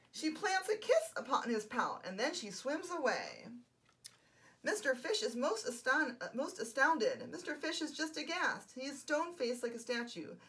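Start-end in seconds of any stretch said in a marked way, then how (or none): none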